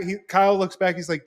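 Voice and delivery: usual speaking voice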